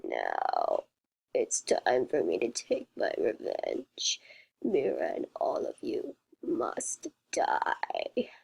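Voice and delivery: very low, slow rasping whisper; creepy whisper. A narrator's reading. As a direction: whisper the threat like a monster. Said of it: "disturbing robot".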